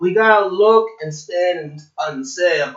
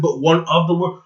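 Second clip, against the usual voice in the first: usual speaking voice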